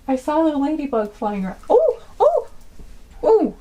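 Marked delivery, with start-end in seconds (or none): 1.7-3.5 surprised. Real, not acted. Ladybug suddenly moves.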